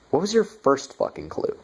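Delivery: exasperated jab. Real, not acted. Mocking the clueless movie characters.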